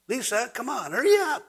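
high pitched, rising whiny rush; impatient mock hurry. Impatient member rushing [name] to finish, high mock whine. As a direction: hurry the singer along in mock exasperation.

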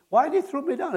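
high, accusing question; lightly voiced upset woman demanding why; indignant woman. Angry woman in the healing line, high accusing.

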